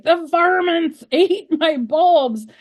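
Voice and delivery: high, slow, laughing complaint; hammed-up indignation at the pests; mock outrage. Telling her husband about the bulbs, hammed-up outrage. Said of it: "And I was like".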